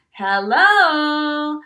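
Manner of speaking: loud, long swooping hello; big, drawn-out hail; called-out greeting. Retold or imitated. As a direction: holler a singsong greeting across the park. Calling to geese and ducks.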